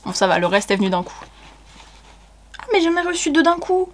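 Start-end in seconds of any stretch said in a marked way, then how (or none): 2.6-3.9 high-pitched, delighted discovery; real surprised delight, pitched up; happy surprise. Real, not acted. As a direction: exclaim it high, pleasantly surprised. Surprised to find two books.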